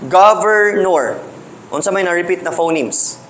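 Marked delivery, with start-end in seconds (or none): 0.1-1.2 "governor" drawn out slowly and a little louder; slow example word. Shown as a demonstration. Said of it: "For example".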